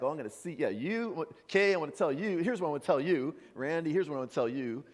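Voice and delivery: loud, rushing mock call-outs; mock eager accuser. Calling out Kay, Randy, Lynn and Pam, in loud mock eagerness. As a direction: ham up calling out friends by name. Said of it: "you think it's like".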